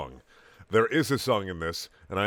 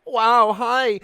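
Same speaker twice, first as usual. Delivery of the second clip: much higher, louder squeaky hi; Tails squeak; 13 semitones higher, 11 dB louder than usual. A narrator's reading. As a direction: pipe up like a small fox. Tails greets friends.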